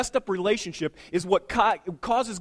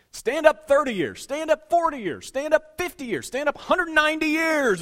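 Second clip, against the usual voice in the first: much higher, louder, building announcer call; mock preacher calling out; 10 semitones higher, 6 dB louder than usual. Preacher calling couples to stand, hammed-up rising call. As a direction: ham up the escalating roll call.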